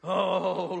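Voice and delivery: usual speaking voice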